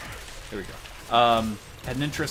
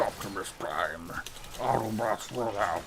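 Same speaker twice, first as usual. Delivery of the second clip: Optimus Prime impression; 3 semitones lower, 5 dB quieter than usual. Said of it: "Transformers impression".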